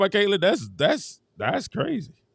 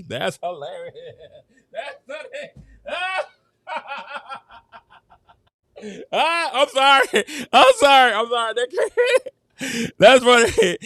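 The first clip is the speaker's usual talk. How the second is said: breaking into real laughter through the words; laughing; 6 semitones higher than usual. Real, not acted. Host cracking up at a quote from a friend, laughing hard. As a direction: giggle and squeal it out, can't stop laughing.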